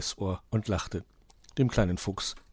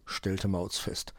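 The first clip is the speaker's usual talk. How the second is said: usual speaking voice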